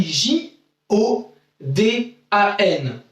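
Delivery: letters J O D A N spelled out slowly one by one; slow spelling. Shown as a demonstration. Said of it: "Ça s'écrit". (épeler J O D A N lentement)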